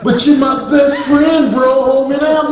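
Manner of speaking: high, drawn-out protest; hammed-up high-pitched appeal; mock pleading friend. Acted plea between best friends.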